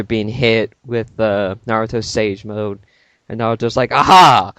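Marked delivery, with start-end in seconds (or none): 3.9-4.5 a loud, gloating "Aha"; triumphant aha. Acted. Naruto spotting the enemy's weakness, loud triumphant exclaim. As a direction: crow it like you caught him.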